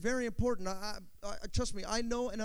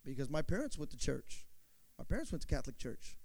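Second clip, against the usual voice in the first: lower, softer, a lightly voiced shrugging churchgoer; 8 semitones lower, 6 dB quieter than usual. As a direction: mumble it like a habit you never questioned. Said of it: "You know what their answer is?".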